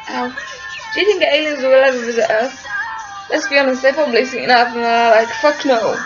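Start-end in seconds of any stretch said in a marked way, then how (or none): none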